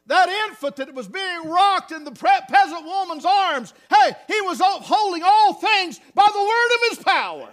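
loud, high, building proclamation; full-force preaching. Acted. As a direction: rise to a shout of praise. Preaching climax.

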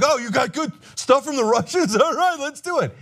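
high, giddy, laughing mock voice; mock eager yes to Russian dirt, laughing; mock Don Junior. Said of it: "he's like".

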